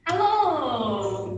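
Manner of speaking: ordinary talk, nothing marked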